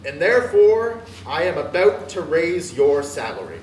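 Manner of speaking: loud, high burst of good news; spring the surprise gleefully; joyful reveal. Acted. Scrooge surprising Bob with a raise, loud and joyful.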